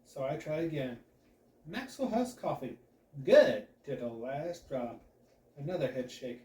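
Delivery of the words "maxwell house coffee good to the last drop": "Good" hit hard and loud, the rest slowed; selling it harder. Acted. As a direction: lean on "Good" to sell the taste. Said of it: "You're not selling how good it is".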